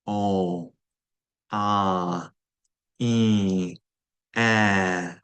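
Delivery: nasal vowels, tongue floating, no N. Shown as a demonstration. Teacher demonstrating nasal vowels without the N. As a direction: let the vowels buzz in the nose. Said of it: "See how I can say it with my tongue floating".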